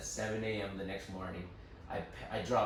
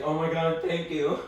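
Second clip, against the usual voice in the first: grateful sister; 7 semitones higher, 9 dB louder, slower than usual. Retold or imitated. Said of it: "my sister's like".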